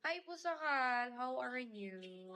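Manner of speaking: ordinary delivery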